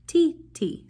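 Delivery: "ti, ti" chanted high and crisp; rhythm syllables ti ti. Shown as a demonstration. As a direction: call out ti, ti to the kids. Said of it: "we would say ta".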